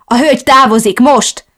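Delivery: határozott utasítás; commanding order. Acted. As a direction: order her out firmly. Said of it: "adta ki az utasítást".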